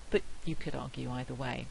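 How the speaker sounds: ordinary delivery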